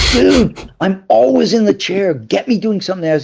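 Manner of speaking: high pleading whine to the director; whiny complaint. Retold or imitated. Actor whining to his director about the chair, high and pleading.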